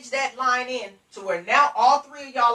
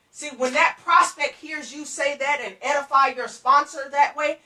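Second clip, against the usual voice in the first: usual speaking voice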